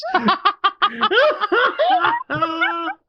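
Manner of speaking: high squealing laugh. Real, not acted. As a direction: burst out laughing. Big laugh at own joke.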